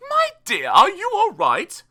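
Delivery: very high, loud fairy voice; high-pitched fairy godmother; magic fairy. A narrator's reading. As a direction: pipe up as a concerned fairy. Fairy's speech voiced in story.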